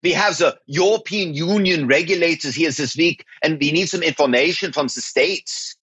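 put-on German-accented caller on the phone; German exec impression. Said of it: "we could imitate people's voices".

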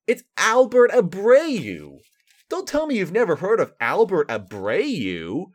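oversell bad stats with sarcasm; sarcastic hype. Royals fan mocking the trade to viewers, sarcastic enthusiasm.

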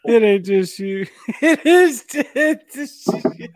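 words squeezed out through laughter; high, giggling, can't stop laughing. Real, not acted. Host laughing at guest.

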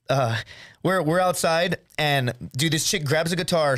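ordinary delivery

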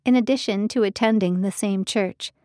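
ordinary delivery, nothing marked

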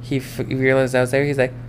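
usual speaking voice

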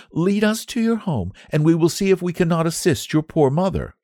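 high, gentle squire's daughter; kind young lady. A narrator's reading. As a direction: offer help to a child kindly. Daughter offers the boy help.

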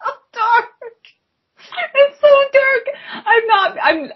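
high, laughing, crying out; nervous laughter. Real, not acted. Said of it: "This is nervous laughter".

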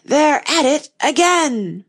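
loud, high, drawn-out shout; top-of-voice shout. A narrator's reading. Messenger shouting news into the King's ear, shouted. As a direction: shout it slowly, as loud as you can. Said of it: "shouted at the top of his voice".

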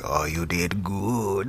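low, slow, quiet evil rasp; most evil voice. Retold or imitated. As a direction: purr it low and sinister. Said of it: "his most evil voice ever".

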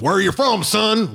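high, jeering taunt; mocking American soldier. American soldier gets in a German prisoner's face, mocking taunt. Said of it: "Like, laughing, you know, 'cause he's a German".

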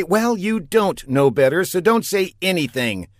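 ordinary delivery, nothing marked

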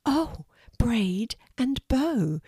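slow, hushed whisper of wonder. A narrator's reading. Calming mermaid whispering as she notices Melody's braid. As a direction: breathe the words out slowly.

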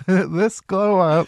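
very slow, drawn-out mock gush; mocking caption voice. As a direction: stretch it out like a cringe caption. Mocking caption on blue cheese.

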